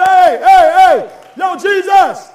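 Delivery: loud shouted hails; calling out. Acted. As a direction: yell to get Jesus' attention. Peter calling out to Jesus.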